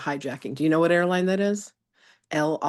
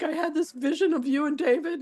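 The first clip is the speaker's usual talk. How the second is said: much higher, a small teary voice; tearful reply; 12 semitones higher than usual. Retold or imitated. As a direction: squeak it out through tears. Answering teacher, tearful, high-pitched.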